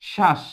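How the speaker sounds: শ্বাস said as "shash"; শ্বাস with the ব-ফলা left silent. Shown as a demonstration. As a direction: say শ্বাস with no b or w sound. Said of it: "যখন আমরা উচ্চারণ করি শ্বাস".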